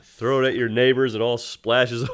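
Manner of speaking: ordinary talk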